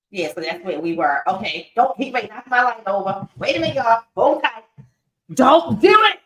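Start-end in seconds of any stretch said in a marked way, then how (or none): 5.3-6.2 loud, high warning shout; sharp warning. Real, not acted. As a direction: call out to stop the animal. Warning a pet near the light.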